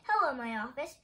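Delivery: ordinary delivery, nothing marked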